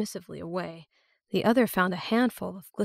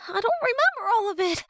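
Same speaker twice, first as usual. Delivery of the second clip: put-on scared young girl; 5 dB louder, faster than usual. A narrator's reading. Romani voiced as scared girl.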